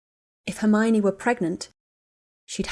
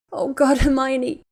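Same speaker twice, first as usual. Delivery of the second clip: gasped; 6 semitones higher, 6 dB louder, slower than usual. A narrator's reading. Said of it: "Ginny gasped".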